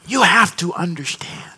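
unvoiced whisper. Real, not acted. Preacher to congregation, whispered aside. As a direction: lean in and whisper it.

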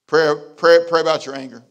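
loud, punched repetition of "pray"; preaching shout; shouted command. Acted. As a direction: drive it home at full voice. Preacher repeats point at full force.

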